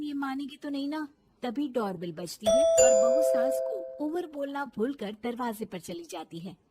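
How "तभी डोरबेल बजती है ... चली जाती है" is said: plain reading voice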